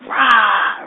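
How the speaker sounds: loud, harsh burst of force; forceful rah. Shown as a demonstration. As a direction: bark out the opposite of gentle. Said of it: "It isn't a".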